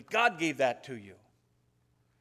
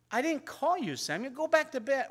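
high-pitched, put-upon priest retelling; retold exasperated complaint, pitched well up; mock exasperation; 9 semitones higher than usual. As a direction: act out Eli fed up with being bothered. Retold exasperated priest sends boy away.

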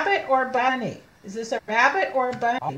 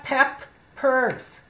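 Peppers broken slowly into pep and pers; sounding out; 3 semitones higher than usual. Shown as a demonstration. Teacher covering one P, Peppers sounded out in two parts. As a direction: say Peppers as two separate chunks.

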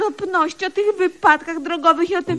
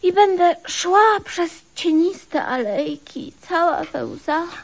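dreamy slow lament; slower than usual. Acted. Dreamy tragic widow fantasy.

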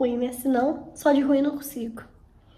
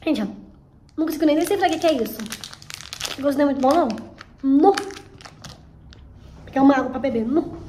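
small candy in mouth; grimacing at bad taste; chewing while talking; faster than usual. Real, not acted. Seen 0: Bit candy at -2; grimacing, mouth working while holding piece.

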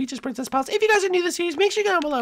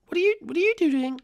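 normal talking voice

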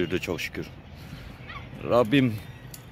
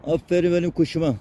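higher, cooing pet talk; fond pet talk; 5 semitones higher than usual. Real, not acted. Feeding strays, praising and cooing at them, higher fond voice. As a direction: coo at the animals as they eat. Talking fondly to animals while feeding.

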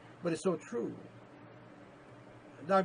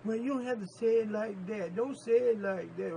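a lightly voiced, slightly higher churchgoer who takes offense; objecting listener; 6 semitones higher than usual. Church member protesting to the preacher, mildly offended. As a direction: play the hearer who doesn't like the preaching. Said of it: "Don't get mad at me".

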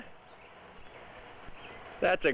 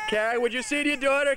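ordinary delivery

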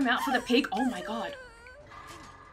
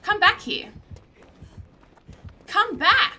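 high, raised-voice call; calling after someone; 7 semitones higher, 6 dB louder than usual. Real, not acted. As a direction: shout for them to return.

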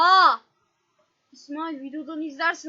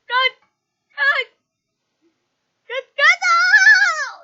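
panicked yelling; 10 semitones higher, 11 dB louder, much slower than usual. Real, not acted. Fleeing killer, yelling.

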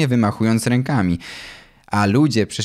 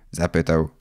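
ordinary delivery

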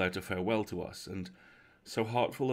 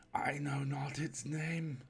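slow, higher, softened reply; drawn-out archaic speech from the dark; distant haunted voice; unseen stranger; 6 semitones higher, 4 dB quieter, slower than usual. Acted. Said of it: "returned the voice".

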